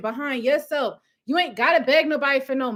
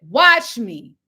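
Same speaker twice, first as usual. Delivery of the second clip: defiant retort; 5 semitones lower, 6 dB louder than usual. Retold or imitated. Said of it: "I said".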